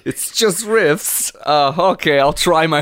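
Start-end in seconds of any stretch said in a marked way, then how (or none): 0.0-1.3 slow, high-pitched shaky try at British; attempted British accent. Co-host told to be British tries the title, poor British accent. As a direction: draw it out in an unconvincing British accent. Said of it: "I don't do a good British".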